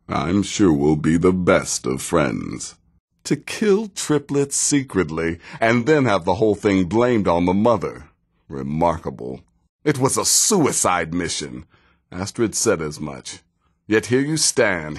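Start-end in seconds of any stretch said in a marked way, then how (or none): none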